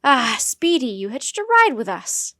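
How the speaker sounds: loud, delighted discovery; greeting a cheeky pet aloud; pleasant surprise. Acted. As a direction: exclaim on finding a little stowaway. Jomo finds his stowaway bush baby.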